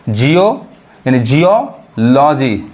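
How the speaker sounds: slow Jio with a rise, then geology split as "jiyo... loji"; slow teaching breakdown of geology; syllable by syllable. Shown as a demonstration. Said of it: "ईओ sound पढ़ाता है".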